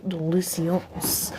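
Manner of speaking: slow belched-out word; burped word. Shown as a demonstration. Burping a word for chat on request. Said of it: "Can you please burp a French word?".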